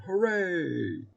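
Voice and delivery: high-pitched hooray; cheering shout. A narrator's reading. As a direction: cheer like a crowd of townspeople.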